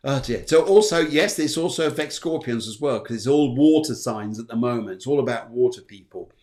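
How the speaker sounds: normal talking voice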